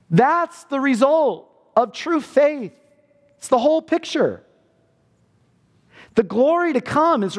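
high, earnest preacher's voice; fervent preaching. Acted. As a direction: preach it with growing fervour. Preaching at full force.

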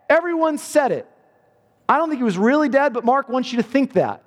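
high, earnest preacher's voice; fervent preaching. Acted. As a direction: preach it with growing fervour. Preaching at rising intensity.

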